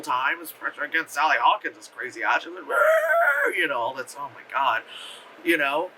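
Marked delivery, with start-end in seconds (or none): 2.6-3.6 very high, held mock shriek; imitated screech. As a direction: screech like a crazy woman. Imitates crazy woman's screeching.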